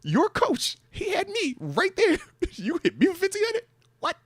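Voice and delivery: high, incredulous protest to the coach; protesting the fine, pitch shooting up; indignant protest. Retold or imitated. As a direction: plead your case in disbelief.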